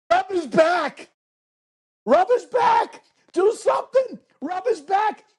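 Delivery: high, loud panicked yelling; frantic yell. Retold or imitated. Producer yelling into the headset, frantic high yell. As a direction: shriek it like a producer losing it. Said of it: "Tommy yells".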